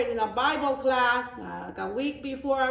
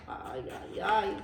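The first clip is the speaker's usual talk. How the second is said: sad sigh; 4 semitones lower, 5 dB quieter than usual. Real, not acted. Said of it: "I feel sad for this goat".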